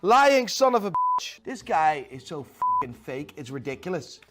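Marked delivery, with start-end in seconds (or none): 0.0-1.4 exasperated fury, voice climbing sharply; angry outburst. Real, not acted. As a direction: rant building to a loud, high yell. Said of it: "I'm just getting emotional and angry".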